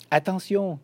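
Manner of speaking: high-pitched storybook cry; narrated shout, pitched well up; shouted warning. A narrator's reading. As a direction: call out a warning, voice jumping up. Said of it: "crie Émile".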